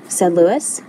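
ordinary delivery

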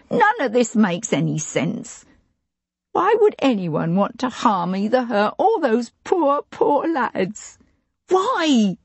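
rising towards a high tearful why; tearful plea. A narrator's reading. Housekeeper pleading with the lord about the lads, rising tearfully. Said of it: "Her puffy face crumpled".

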